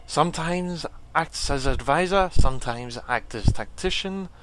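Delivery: painful character voice. Acted. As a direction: put on a strained warrior voice, deliberately paced. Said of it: "the most painful voice for him".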